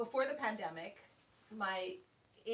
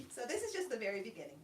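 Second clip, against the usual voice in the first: ordinary talk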